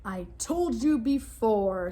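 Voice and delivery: impatient trapped lion; weary lion. Acted. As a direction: drag it out impatiently. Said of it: "said the lion".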